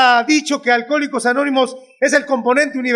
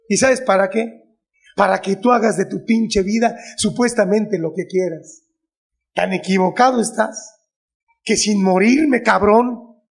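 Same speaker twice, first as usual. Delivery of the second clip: lower-pitched, lightly voiced reproachful mother; madre que reprocha; 5 semitones lower than usual. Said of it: "un día me dijo". (imitando levemente el reproche de una madre)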